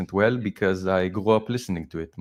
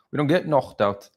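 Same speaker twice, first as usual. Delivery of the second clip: high-pitched Scouse impression, K turned throaty; Paddy Pimblett; 6 semitones higher than usual. Showing viewers the Scouse K, imitated Scouse accent. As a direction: mimic Liverpool speech with a guttural K. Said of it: "their K is a "cha".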